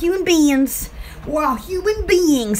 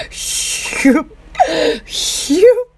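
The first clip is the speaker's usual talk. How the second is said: whooshing imitation of a washer; washing-machine noise; 4 dB louder than usual. Shown as a demonstration. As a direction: imitate a sloshing washing machine with your mouth. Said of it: "That's what it sounds like".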